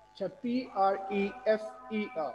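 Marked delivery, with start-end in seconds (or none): none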